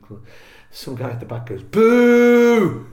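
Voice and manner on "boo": a loud, high boo; heckler's boo. Retold or imitated. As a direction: heckle from the back of the room. Heckler booing from the back.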